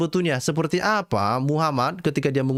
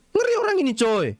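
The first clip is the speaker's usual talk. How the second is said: aghast cry of disbelief; horrified cry; 11 semitones higher than usual. Real, not acted. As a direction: burst out in horror. Horrified exclamation.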